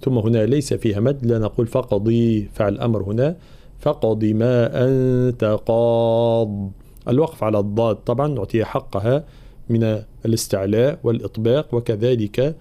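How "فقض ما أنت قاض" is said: slow recitation of "فقض" with a bouncing قاف and no lengthened vowel after the ضاد; Quranic recitation. Shown as a demonstration. Teacher modelling qalqala for students, slow and deliberate. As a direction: echo the قاف in "فقض", keep it short. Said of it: "لابد من قلقلة القاف في كلمة فقض".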